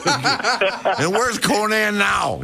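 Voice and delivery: slow laughing holler; laughing shout. Real, not acted. Laughing outburst at old memory.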